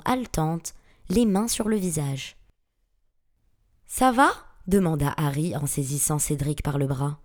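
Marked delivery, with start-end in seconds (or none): 3.9-4.3 higher, louder, urgent check; raised-pitch worry; concerned question. A narrator's reading. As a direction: ask anxiously after an injured friend. Harry checks on his injured friend.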